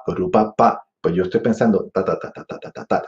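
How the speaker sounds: usual speaking voice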